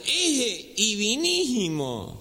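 slow, drawn-out nasal mangling; gangoso. Acted. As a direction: say "divinísimo" through the nose with the consonants dropped. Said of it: "medio gangoso era". (imitación gangosa, lenta, sin consonantes)

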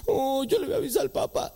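put-on high, whining child threat; a boy threatening to tell his father; whiny kid. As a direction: whine a threat to tell dad. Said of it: "kid threatens".

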